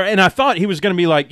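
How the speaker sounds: normal talking voice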